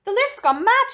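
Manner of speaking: high, loud cry of panic; alarmed shout. A narrator's reading. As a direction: shout in terror at the runaway lift. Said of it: "shouted Grandpa Joe".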